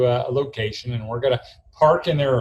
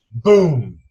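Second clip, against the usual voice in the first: loud punchy mock boom of a slammed door; sharp loud boom; door slam sound; 8 dB louder than usual.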